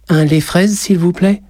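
put-on high-pitched small child's voice; polite child, pitched well up; little boy. A narrator's reading. Narrator voices shy little boy ordering.

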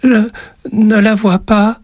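put-on very high, slow, halting child voice; frightened little boy. A narrator's reading. Boy barely manages to answer the witch, frightened. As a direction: force the words out like a scared child. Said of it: "réussit-il tout de même à dire".

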